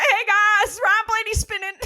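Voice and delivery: high, sheepish "hey guys"; timid interruption. Retold or imitated. Imitating Dale Junior breaking into the booth chatter, high sheepish call. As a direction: interrupt sorry but urgent. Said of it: "he felt really bad for interrupting".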